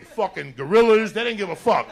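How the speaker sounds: normal talking voice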